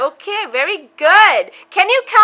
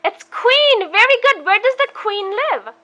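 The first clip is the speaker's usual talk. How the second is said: put-on reading to a toddler. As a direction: coax the little one through pictures. Talks a toddler through pictures.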